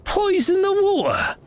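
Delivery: very high, incredulous echo; alarmed disbelief. Acted. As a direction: squeak it back in shock, falling at the end. Guard alarmed at colleague's idea.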